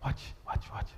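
hushed whisper. Real, not acted. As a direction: drop to a whisper. Preacher hushes crowd.

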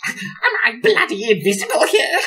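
high-pitched, indignant outburst in character; hammed-up high squawk of complaint; Arnold. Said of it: "exactly how Benji portrays Arnold".

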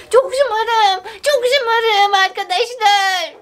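high, drawn-out mock whine; stretched-out, pretend spoiled wail; hammed-up bratty whine. Hams up being spoiled.

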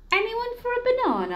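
high, loud, cheery offer; jolly elephant. Acted. Elephant offering bananas, high and jolly.

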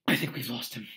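out of breath from running; panting. Acted. Harry to his friends after the chase, panting. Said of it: "Harry panted".

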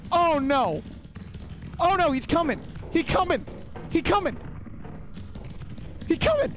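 high, frantic fear; panicked. Real, not acted. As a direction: voice climbing as the monster nears. Monster approaching, panic.

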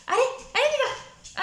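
very high, eager coaxing; excited dog call. Real, not acted. Trainer urging the poodle to come along, very high. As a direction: lift the voice right up to lure him.

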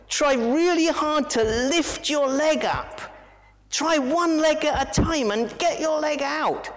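high urging voice; Toto urging. Acted. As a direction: coax him on from the bank.

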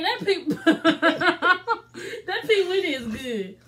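giggling through the words; laughing. Real, not acted. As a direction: laugh it out, drawn slow. Laughing while praising the drink.

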